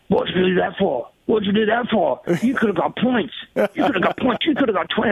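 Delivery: a touch of the fed-up team boss; annoyed boss. Retold or imitated. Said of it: "he's so annoyed, and he goes".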